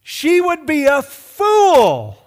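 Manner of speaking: slow, drawn-out high shout; full-force preacher's exclamation; thundering outburst. Real, not acted. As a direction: bellow it, stretching the last word. Preacher's outburst at climax of story.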